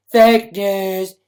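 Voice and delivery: loud, high mock outcry; mock shout. Streamer dismissing a storm looting rumour, mock shouting. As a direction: blare it like a heckler.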